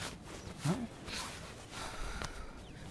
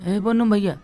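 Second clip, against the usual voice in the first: normal talking voice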